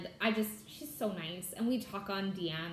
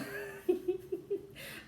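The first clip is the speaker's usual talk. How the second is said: soft breathy laugh; 10 semitones higher, 5 dB quieter than usual. Real, not acted. Laughing at her love of accents.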